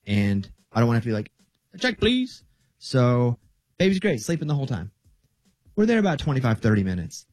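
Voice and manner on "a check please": much higher-pitched mock request; calling for the check. Dad imagining a quick restaurant exit, high mock call for the check.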